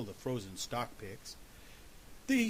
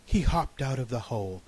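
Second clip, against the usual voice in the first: plain reading voice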